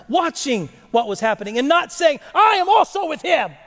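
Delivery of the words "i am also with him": high, emphatic mock declaration; bold declaration. Preacher voicing what Peter never said, high and bold. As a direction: call it out boldly, pitched right up. The boast Peter failed to shout.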